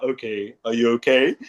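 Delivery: deep dad voice. Retold or imitated. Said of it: "Because of deep voice".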